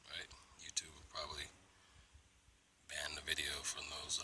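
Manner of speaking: hushed whisper. Real, not acted. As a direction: whisper it like a secret. Whispering about YouTube ban.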